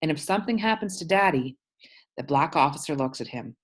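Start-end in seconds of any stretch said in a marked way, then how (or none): none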